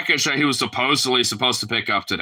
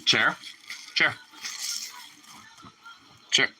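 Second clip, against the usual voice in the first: ordinary delivery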